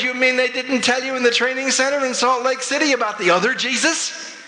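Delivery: high, fast incredulous question; playing shocked, voice up throughout; feigned astonishment. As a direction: rush it out in high-pitched mock disbelief. Feigned astonishment at young missionaries.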